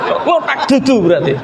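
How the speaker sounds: lightly put-on voice, laughing; mock questioning voice. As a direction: ask it again in a teasing borrowed voice. (meniru suara orang bertanya sambil tertawa)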